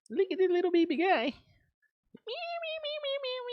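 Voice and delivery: very high-pitched cutesy squeal; squeaky baby-talk voice with high meows; baby talk and meowing. Pretend. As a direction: coo at a tiny creature, then mew like a kitten. Cooing at a pet, imitating meows.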